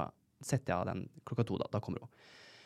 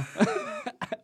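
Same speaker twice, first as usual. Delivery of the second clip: high laughing burst. Real, not acted. Laughing at own story.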